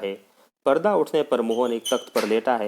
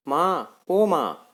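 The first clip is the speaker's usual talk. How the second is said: pained wail, rising higher; loud groaning cry; 6 semitones higher, much slower than usual. Acted. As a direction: call out for mother through stomach pain. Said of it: "Mohan जोर से कराहकर".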